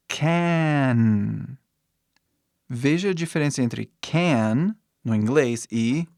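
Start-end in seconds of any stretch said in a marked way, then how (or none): 0.1-1.6 "Can" drawn out onto a held N; English final N. Shown as a demonstration. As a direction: stretch "Can" and close with the tongue on N. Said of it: "você fecha com a língua".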